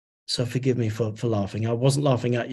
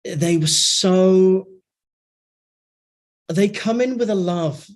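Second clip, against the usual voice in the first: slow, higher, voice close to breaking; near tears; 5 semitones higher, 5 dB louder, slower than usual. Real, not acted. Describing the beings' love at the launch, near tears. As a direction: fight back the tears. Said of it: "I almost started crying because".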